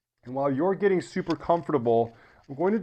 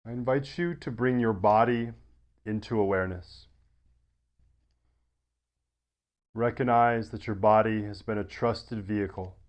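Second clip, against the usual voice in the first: guided meditation; 4 semitones lower than usual. Acted. Yoga teacher settling listeners into deep rest, guided meditation. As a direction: ease them gently into rest, low and unhurried.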